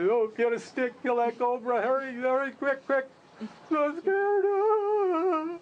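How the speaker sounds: high, panicked acted plea; urgent squeal rising to a long, very high wail; frightened cry for help. Traveler begs old man to kill the cobra, panicked.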